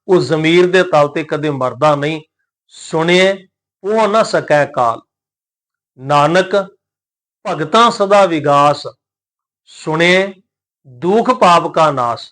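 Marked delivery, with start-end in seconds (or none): 2.7-12.3 slow, measured recitation of sacred verse; scripture recitation. Shown as a demonstration. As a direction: recite each word drawn out, with pauses.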